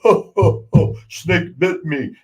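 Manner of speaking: a light imitation of a slow, chuckling giant; giggling Andre. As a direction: chuckle it out slowly, amused by the bite. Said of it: "he's giggling".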